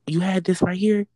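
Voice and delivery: mock-sweet gotcha to coworker; pointed question. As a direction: ask sweetly, making a point. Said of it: "Did you have this barbecue cup".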